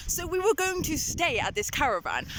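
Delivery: ordinary talk, nothing marked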